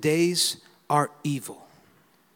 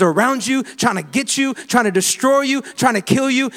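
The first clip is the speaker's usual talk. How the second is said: loud, high, driving sermon voice; full-force revival preaching; 8 semitones higher, 6 dB louder than usual. Real, not acted. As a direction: rouse the congregation, push hard.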